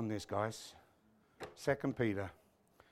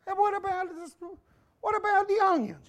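very high-pitched mock son; questioning Italian son; 13 semitones higher than usual. Son quizzing Papa about the sauce, high mock voice. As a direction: pester Papa in a squeaky high voice. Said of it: "this Italian guy goes home".

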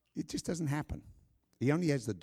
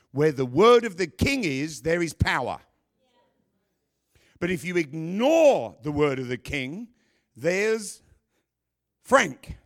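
preaching at full force; 7 dB louder than usual. Acted. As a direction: call it out to the whole room. Loud climactic preaching line.

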